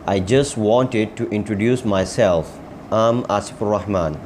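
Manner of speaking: joined up in connected phrases; whole-sentence model. Shown as a demonstration. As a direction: say the practice sentence through without word gaps. Same sentence repeated as a whole.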